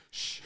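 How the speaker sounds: imitated nervous "shh"; unvoiced-style hissed shush; hushing. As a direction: shush someone like a scared friend. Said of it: "He was like".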